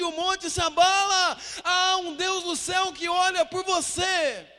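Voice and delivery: climactic preaching. Real, not acted. Pastor proclaiming God's unseen work, climactic preaching.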